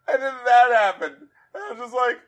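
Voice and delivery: laughing through the words. Real, not acted. Host laughing while telling co-host about the lyric.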